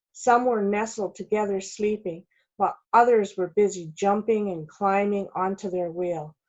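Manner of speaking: ordinary delivery, nothing marked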